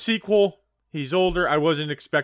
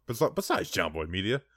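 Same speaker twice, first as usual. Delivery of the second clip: ordinary talk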